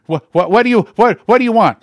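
high, loud, stammering in fear; frightened official stuttering at the door; terrified stammer. Retold or imitated. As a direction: stumble over what like a shaking man. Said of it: "He was shaking all over".

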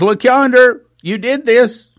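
high, loud pointing accusation; accusing devil. Retold or imitated. The devil pointing the finger at you, loud accusing voice. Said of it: "He points the finger and says".

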